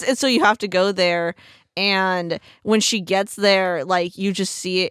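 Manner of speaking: ordinary talk, nothing marked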